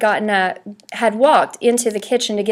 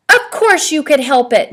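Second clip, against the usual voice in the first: mild scolding; 4 semitones higher, 5 dB louder than usual. A narrator's reading. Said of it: "Mom scolded".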